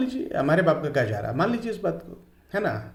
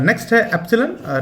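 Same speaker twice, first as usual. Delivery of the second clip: ordinary talk, nothing marked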